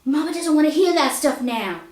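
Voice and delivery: high, loud defensive yelp; hurt protest. Acted. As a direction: whine back after the smack. Cousin yelps after being smacked.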